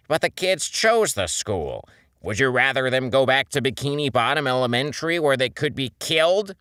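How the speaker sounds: fiery anger. A narrator's reading. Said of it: "felt a fire in his eyes".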